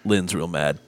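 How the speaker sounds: a lightly voiced drunk confiding; drunk Justin. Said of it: "and he goes".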